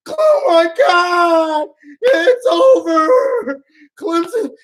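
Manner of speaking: hammed-up despair, drawn out; mock wail. Coach sobbing in mock skit.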